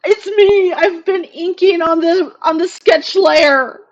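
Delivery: loud anguished complaint; loud dismayed wail. Real, not acted. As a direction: cry out in frustration. Wailing over ruined inks.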